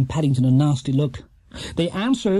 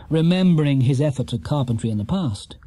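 ordinary delivery, nothing marked

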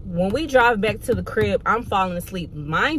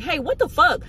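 retold spazzing out; 6 semitones higher than usual. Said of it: "I'm spazzing".

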